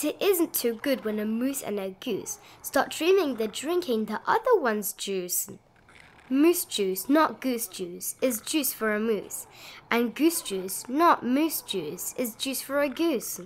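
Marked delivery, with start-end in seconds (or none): none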